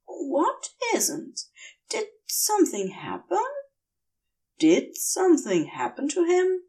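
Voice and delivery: a woozy angel asking in bewilderment; dazed angel, confused. Acted. Dazed angel voiced by narrator.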